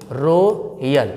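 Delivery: रॉयल very slowly as "rooo... yal"; slow wrong "ro / yal" for रॉयल; common mistake, split. Shown as a demonstration. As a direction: hold a round O in रॉयल, then detach "yal". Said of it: "लेकिन रॉयल नहीं".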